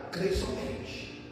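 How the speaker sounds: hushed, slow and low; soft, drawn-out words of comfort; quiet reassurance. Acted. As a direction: drop almost to a murmur to reassure. Jesus reassures Jairus.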